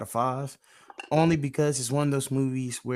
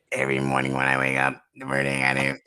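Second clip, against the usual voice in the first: commercial voice imitation; 12 semitones lower than usual. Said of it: "you didn't even need the voice for it".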